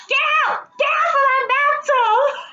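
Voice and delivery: high frantic yell; screaming. Retold or imitated. Woman in the shower yelling at a spider, panicked scream. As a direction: shriek at the spider to leave. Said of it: "I'm screaming at the spider".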